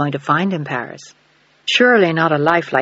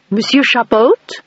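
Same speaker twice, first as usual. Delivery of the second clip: put-on puzzled French with a heavy American accent, higher and slow; 6 semitones higher, 4 dB louder, slower than usual. A narrator's reading. Countess answering the phone to a stranger, puzzled, in a heavy American accent. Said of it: "with a heavy American accent, sounding puzzled".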